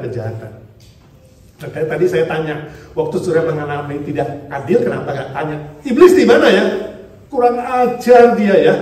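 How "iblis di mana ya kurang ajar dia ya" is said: mock scolding.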